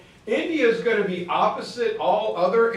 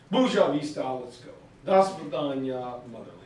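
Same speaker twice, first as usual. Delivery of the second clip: put-on Russian accent; 6 semitones lower, faster than usual. Migrants bidding the motherland farewell, Russian accent. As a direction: say goodbye in a Russian accent, trailing low. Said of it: "losing their Russian accent".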